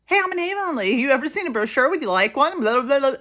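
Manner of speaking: hammed-up pushy sales pitch; pushy Avon lady. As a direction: imitate an overeager seller at the register. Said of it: "they'll be like".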